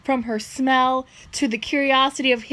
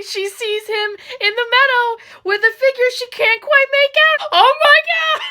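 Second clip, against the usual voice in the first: climbs higher and louder to a squeal; rising excitement; 10 semitones higher, 5 dB louder than usual. Real, not acted. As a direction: build up to a thrilled shriek. Gasping at Edward's vision.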